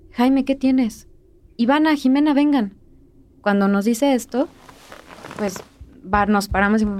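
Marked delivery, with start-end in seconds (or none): 0.1-2.8 imitating mom's raised, higher alarmed call. Mom calls her daughters from the bedroom, alarmed raised shout. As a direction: call out to the girls, worried.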